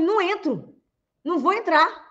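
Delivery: high-pitched, insistent refusal; standing your ground under threat; firm refusal. Acted. Acted refusal to an assailant.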